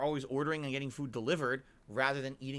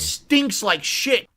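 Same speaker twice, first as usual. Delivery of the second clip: slow loud drawl; drawn-out loud shout; 6 semitones higher, 13 dB louder, much slower than usual. Acted. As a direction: drag it out, loud. Clip voice shouted.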